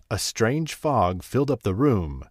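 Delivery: usual speaking voice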